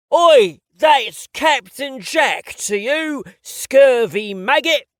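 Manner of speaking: slow, loud, hammed-up English pirate accent; hammy English pirate accent. Playing pirate with a little boy, bad English pirate accent. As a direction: growl it like a stage English pirate. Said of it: "my horrible English accent".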